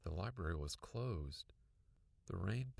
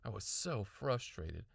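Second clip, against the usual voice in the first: ordinary delivery, nothing marked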